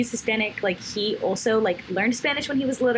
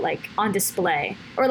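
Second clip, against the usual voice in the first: usual speaking voice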